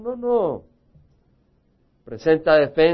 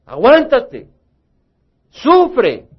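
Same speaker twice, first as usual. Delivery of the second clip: higher, louder and slow, a firm command; firm voice of God; 5 semitones higher, 6 dB louder, much slower than usual. Retold or imitated. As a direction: hand down the order slowly and firmly, like God speaking. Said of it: "Dios dice". (voz de Dios, alta, fuerte y lenta, dando una orden firme)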